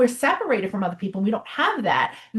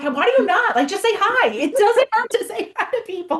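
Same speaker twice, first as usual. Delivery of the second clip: higher-pitched playful protest; mock-exasperated retort; 8 semitones higher, 3 dB louder than usual. Mother answering her kids about talking to people, mock-exasperated. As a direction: argue back in a high, incredulous voice.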